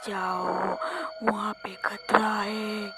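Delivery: low, slow ghostly warning; eerie warning voice. Acted. Unseen voice warns her away from the mansion, eerie and low. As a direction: draw out a hushed, ominous warning. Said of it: "भयानक सा थोड़ा आवाज़ सुनाई दिया".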